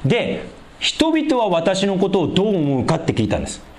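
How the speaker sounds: normal talking voice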